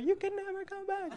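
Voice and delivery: very high, hushed echo of a warning; mock fantasy-film warning. Comedian parodying the visa warning, hushed and high melodrama. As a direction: float it high and soft, melodramatically. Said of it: "like the never-ending story".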